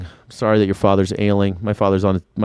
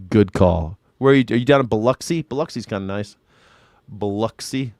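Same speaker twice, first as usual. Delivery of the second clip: ordinary talk, nothing marked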